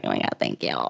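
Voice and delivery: low, soft creaky aside; mock gratitude. Answering a friend's teasing insult, low soft mock thanks. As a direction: drop right down, hushed and low.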